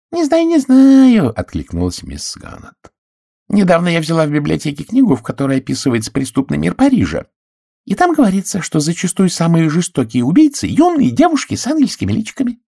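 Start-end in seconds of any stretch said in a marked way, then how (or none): 0.1-1.4 high, loud, slow darkly knowing; ominous doubt. A narrator's reading. Miss Gannett darkly hinting, high, loud and slow. 3.5-12.6 high, loud breathless spinster; spinster sharing gossip. A narrator's reading. Miss Gannett relates the Paris book, high and loud.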